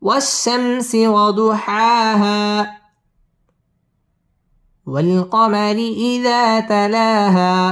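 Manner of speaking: slow, melodic tajweed chant with long held vowels; Quran recitation. Real, not acted. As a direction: recite each verse slowly, pausing between them. Teacher recites the surah melodically. (ধীরে সুর করে কুরআন তিলাওয়াত)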